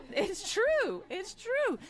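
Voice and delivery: laughing. Real, not acted. Laughing reply to her own joke.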